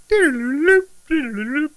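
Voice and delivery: high trilled bird warble; imitated bird call. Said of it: "There's a bird that's like".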